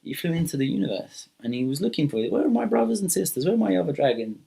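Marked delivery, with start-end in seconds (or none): 2.3-4.4 higher-pitched, puzzled questioning; searching call. Acted. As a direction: wonder aloud where everyone has gone. Dragon searching for his lost siblings.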